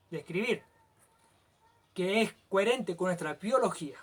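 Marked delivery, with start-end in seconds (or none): none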